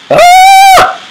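sharp high-pitched scream; startled shriek. Real, not acted. Gamer caught off guard by an enemy, sharp high shriek. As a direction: shriek at a sudden threat.

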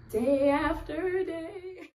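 high, slow, breaking on the words; mother near tears over her daily struggle; tearful repeat. Real, not acted. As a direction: stretch it out as the tears come.

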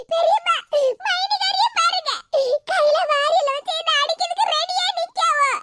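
high, hammed-up blubbering; tattling in put-on tears; fake sobbing. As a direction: sob theatrically between the words. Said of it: "என்ன நடிப்பு நடிக்க".

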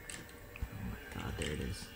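breathless low whisper; scared whisper not to be heard; whispered gasp. Real, not acted. Granny appears, hushed.

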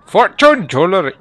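loud, high attempt at a Chinese accent; hammed-up, unconvincing Chinese accent; mock Chinese accent. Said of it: "that's not really a Chinese accent".

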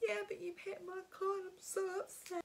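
high sobbing wail; hysterical crying. Retold or imitated. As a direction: blurt it out between sobs. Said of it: "I was literally hysterically crying".

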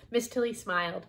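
plain reading voice

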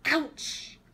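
sudden high yelp of pain; bumped head, high yelp; ouch cry. Acted. Herb bumps his head, sharp high ouch.

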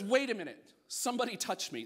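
loud and high, suddenly alarmed; urgent exclamation. Acted. Jesus halting the crowd, a loud high startled call. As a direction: stop everyone, sharp and loud. Jesus feels the healing power leave.

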